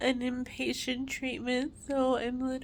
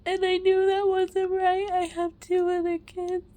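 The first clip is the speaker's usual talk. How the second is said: tearful, higher and louder; crying through the words; snotty crying; 5 semitones higher, 5 dB louder than usual. Real, not acted. Mother confessing postpartum panic, tearful.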